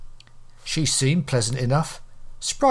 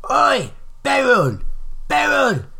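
hollering; 10 semitones higher, 4 dB louder than usual. A narrator's reading. Old woman calls her friend over from across the way, hollering. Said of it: "she hollered".

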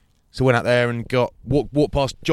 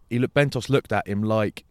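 ordinary talk

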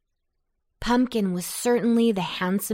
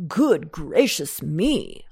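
drawn-out witch exclamation; witch crying out; 3 semitones lower, slower than usual. A narrator's reading. Witch noticing the night is over, crying out. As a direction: exclaim slowly in a witch's character voice. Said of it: "cried a witch".

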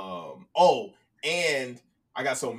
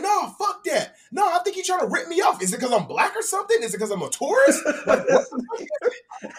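higher, indignant retold rant; mock outrage breaking into a laugh; going off; 5 dB louder than usual. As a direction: accuse the vendor of ripping him off, hammed up. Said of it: "going off on this dude".

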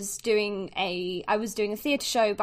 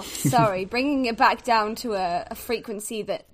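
usual speaking voice

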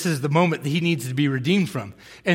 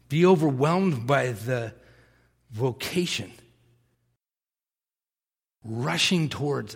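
soft, lower, hushed sermon close; quiet preaching; 4 semitones lower, 4 dB quieter than usual. Real, not acted. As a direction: draw the listeners in gently. Closing sermon exhortation.

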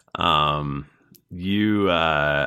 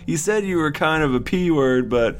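high, excited bro voice; higher pitched surfer bro; stoner voice; 9 semitones higher than usual. Acted. Uthgar voiced on the phone.

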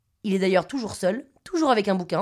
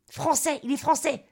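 higher, exclaimed realisation; pitched-up little eureka; excited discovery; 5 semitones higher than usual. Acted. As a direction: blurt out the triumphant deduction.

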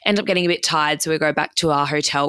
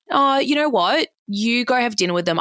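pointed sarcasm; 8 semitones higher than usual. Retold or imitated. Pointed retort to boyfriend.

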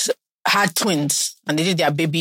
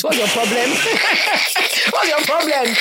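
playful mock scold; 8 semitones higher than usual. Real, not acted. Host teases cohost over giveaway, laughing mock scold. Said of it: "playful scolding with laugh".

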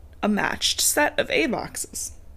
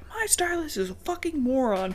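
higher, softer put-on complaint; mock Joanna gripe; 6 semitones higher, 6 dB quieter than usual. Joanna griping about her stylist, higher put-on voice. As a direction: toss off the insult in a mock voice. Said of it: "And she's like".

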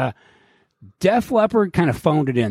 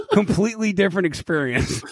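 laughing through the words; amused, higher-pitched, breaking out of a laugh; 5 semitones higher than usual. Real, not acted. Laughing at own sober confession.

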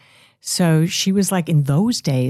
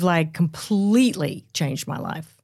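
ordinary delivery

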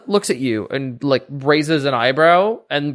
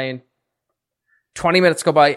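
ordinary talk

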